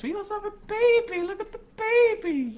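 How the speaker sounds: very high, loud squeal of delight; squealing over a cute baby. Acted. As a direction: coo at the baby in a shrill falsetto. Cooing over a baby animal.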